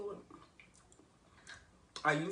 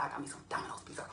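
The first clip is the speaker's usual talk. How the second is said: whispering; 3 dB quieter, much faster than usual. Pretend. Whispered repeat.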